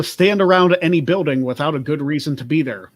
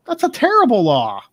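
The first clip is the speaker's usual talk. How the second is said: mock outrage; 9 semitones higher, 3 dB louder than usual. Mock outrage at hamburger law.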